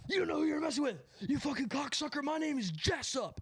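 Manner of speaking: high, strained tough-guy yell; hammed-up high-voiced road rage; mock yelling driver. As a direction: imitate an angry driver shouting out the window. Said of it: "he puts his head out the window and he goes".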